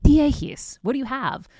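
put-on high Greek mom accent; exasperated Greek mother, high. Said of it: "my mom would say in her Greek accent".

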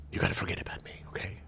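hushed, mostly whispered plea; imitated quiet voice. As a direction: play a dad secretly counselling his boys. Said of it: "He's being all quiet".

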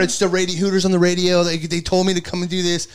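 high-pitched, babbled excuse; retold tearful pleading. College kid showing the cop his shirt, mock pleading. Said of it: "I literally started crying".

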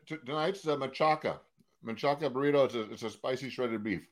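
ordinary delivery

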